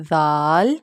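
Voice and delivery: زال held long with soft "dh"; gentle, drawn-out زال; soft letter sound. Shown as a demonstration. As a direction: soften the first sound of زال to "dh". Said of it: "soft پڑھیں گے انہیں".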